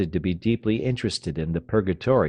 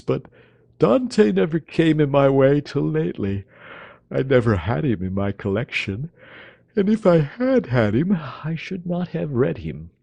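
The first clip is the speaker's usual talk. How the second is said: languid dandy; 7 semitones higher, 4 dB louder than usual. Acted. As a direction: sigh it out as too much effort.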